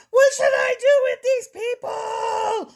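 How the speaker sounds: high, slow anguished shout; desperate cry. Acted. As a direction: cry out in despair. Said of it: "Moses cried out to the Lord".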